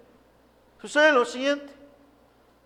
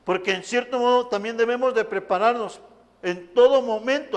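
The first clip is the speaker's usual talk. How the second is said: ordinary talk, nothing marked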